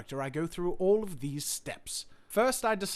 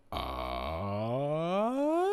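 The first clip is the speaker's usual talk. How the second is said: register sweep; 5 semitones lower than usual. Shown as a demonstration. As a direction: slide an "uh" up through the registers. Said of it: "go through each register one by one".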